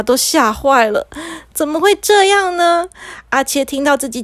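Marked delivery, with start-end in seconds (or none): 1.5-2.9 high-pitched disbelieving question; aghast, pitched up; shocked dismay. Acted. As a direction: ask in alarm how this could happen. Parents shocked by boy's gibberish.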